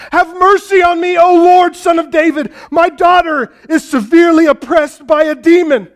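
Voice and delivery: a mother crying out for her daughter; desperate crying out. Retold or imitated. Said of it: "we hear this, constantly this".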